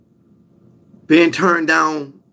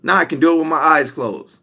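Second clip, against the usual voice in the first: normal talking voice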